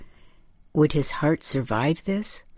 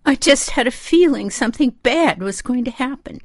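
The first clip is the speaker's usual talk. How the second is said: high, distressed mother's voice; high-pitched, fretful lament; moaning; 10 semitones higher, 4 dB louder than usual. A narrator's reading. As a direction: moan it high, full of upset. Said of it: "she moaned".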